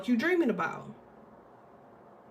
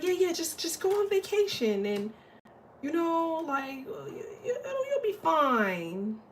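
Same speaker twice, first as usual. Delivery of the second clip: dismissive brush-off; 7 semitones higher than usual. Retold or imitated. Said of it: "Somebody's like".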